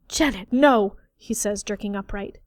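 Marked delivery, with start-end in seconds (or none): 0.1-1.0 loud alarmed protest; alarmed cry. A narrator's reading. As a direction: cry out to stop her.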